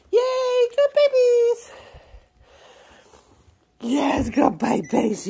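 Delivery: high, loud, slow doggy praise. Real, not acted. Owner praising dogs that learned, loud high praise. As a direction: cheer the pups on.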